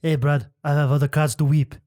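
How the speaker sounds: mock Frenchman's English; French accent. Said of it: "say it like a Frenchman".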